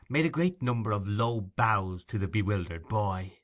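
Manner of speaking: usual speaking voice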